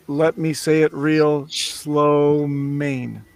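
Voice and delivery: very slow, stretching out SLOW and MAIN; deliberately slowed, long vowels on the last two words; drawn-out slow title. Shown as a demonstration. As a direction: say the title at half speed, lingering on "Slow Main". Pronouncing the meme title for listeners.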